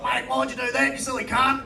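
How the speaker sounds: loud mock Australian accent, indignant granddad; granddad's put-on Australian accent. Granddad scolds grandson for quitting drink, put-on Australian accent. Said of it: "You've got to stop doing the accent".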